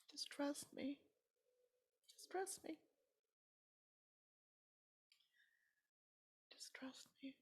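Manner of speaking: high breathy whisper fading away; God's whispered answer. Retold or imitated. God answering a grieving mother, in a fading whisper. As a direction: whisper it high and let it fade. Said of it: "all I'd get is".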